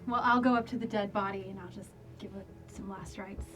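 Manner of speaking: normal talking voice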